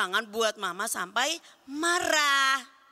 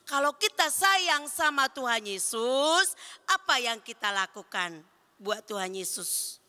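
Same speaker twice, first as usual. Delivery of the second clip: ordinary talk, nothing marked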